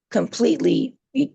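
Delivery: ordinary talk, nothing marked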